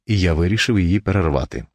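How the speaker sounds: ordinary delivery, nothing marked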